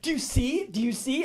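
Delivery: high-pitched, insistent imitation; mock frantic appeal. Storyteller imitating houseguest appealing to cops, high and insistent. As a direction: mimic her pressing the police, pitched high.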